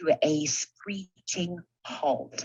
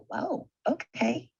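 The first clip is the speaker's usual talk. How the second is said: surprised; 11 semitones higher, slower than usual. Retold or imitated. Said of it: "they were like".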